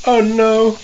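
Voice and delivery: high panicked wail; scared horror game streamer. Real, not acted. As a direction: squeal it in rising dismay. Camel falls, repeated wailing.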